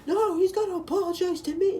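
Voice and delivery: high-pitched, loud imitation of an angry classmate; mock yelling. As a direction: yell it like a whiny, outraged kid. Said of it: "He's, like, yelling at me".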